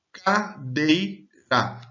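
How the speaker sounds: "Cadeira" very slowly in three pulses, CA, DEI, RA; split into syllables. Shown as a demonstration. Teacher showing three voice emissions, "Cadeira" slowly syllable by syllable. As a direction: pause between each syllable of "Cadeira". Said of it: "Há três emissões de voz nessa palavra".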